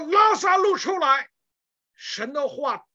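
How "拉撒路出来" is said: a high, shouted summons; loud call. Retold or imitated. Preacher retelling Jesus calling Lazarus, shouted summons. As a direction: shout the name out like a command. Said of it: "他说".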